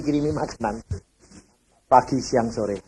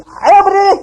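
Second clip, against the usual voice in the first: urging; 10 dB louder than usual. Retold or imitated. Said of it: "Terus dia bilang".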